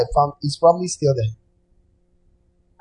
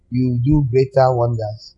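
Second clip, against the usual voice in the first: ordinary talk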